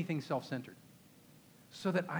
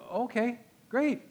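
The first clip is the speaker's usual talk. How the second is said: delighted relief; 7 semitones higher, 4 dB louder, slower than usual. Acted. Moses accepting God's yes.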